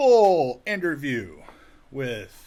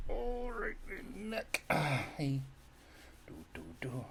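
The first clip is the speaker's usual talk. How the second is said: ordinary talk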